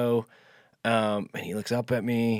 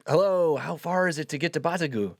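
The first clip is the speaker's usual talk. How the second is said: calling out; 6 semitones higher than usual. Retold or imitated. Translates what was called to farmer.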